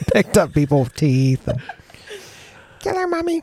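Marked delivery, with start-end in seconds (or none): 2.8-3.4 put-on high eerie kid voice; creepy little child pleading; child's voice. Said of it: "she says in a child's voice".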